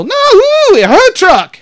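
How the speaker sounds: high, loud mock group outcry; girls protesting. Girls pointing out the real owner, high mock protest. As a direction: squeal the correction like imitated girls. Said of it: "And they all go".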